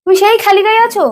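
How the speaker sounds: 高声责问，语调上扬; 半夜发火地质问丈夫; scolding wife. Real, not acted. Wife scolds husband late night.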